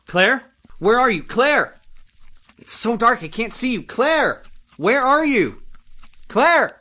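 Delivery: loud, high desperate calls; desperate calling. Acted. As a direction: yell into the dark for her. Husband searching for vanished wife.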